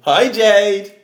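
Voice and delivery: high, loud cheery hello; loud greeting. Real, not acted. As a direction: call out a big hi. Group greeting sister.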